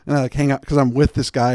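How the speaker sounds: usual speaking voice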